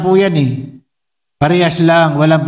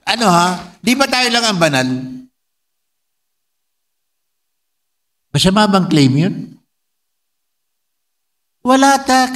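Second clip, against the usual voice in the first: higher, mock-smug voice of a rival church; sarcastic mock boast; 7 semitones higher than usual. Preacher mimicking a rival church's boast, sarcastic. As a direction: play their smug claim back at them.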